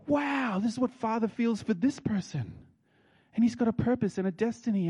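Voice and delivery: high-pitched amazed wonder; awestruck. Acted. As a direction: marvel at this person, voice lifted high. Preacher voicing his awe at someone.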